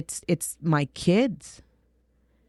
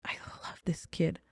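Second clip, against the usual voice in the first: hushed whisper of fondness; whispered aside; 12 dB quieter than usual. Retold or imitated. Mother thinking about her daughter, whispered fondly. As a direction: breathe it out as a secret. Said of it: "And I'm like".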